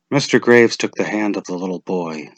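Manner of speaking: ordinary delivery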